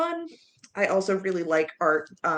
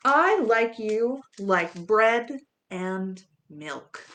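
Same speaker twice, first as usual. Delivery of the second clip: playful picture-book reading with pig oinks and a mock gasp; reading to children; 4 semitones higher, 6 dB louder, slower than usual. A narrator's reading. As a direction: ham it up for the little listeners.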